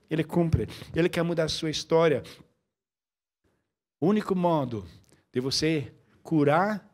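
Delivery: ordinary talk, nothing marked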